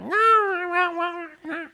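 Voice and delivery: high, stubborn mock Lou; Lou refusing. Lou refuses to turn around, high and stubborn. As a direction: dig in like a child.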